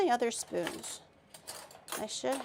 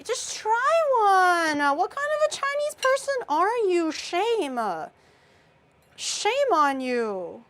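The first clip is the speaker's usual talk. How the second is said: loud, high, drawn-out shaming; mock scolding; 8 semitones higher, 5 dB louder, slower than usual. Real, not acted. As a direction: scold him playfully, loud and slow. Pushing him to try tomato.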